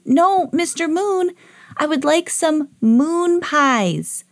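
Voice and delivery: high, slow, over-enunciated call; exasperated yelling. A narrator's reading. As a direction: spell it out for someone hard of hearing. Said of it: "you don't have to yell".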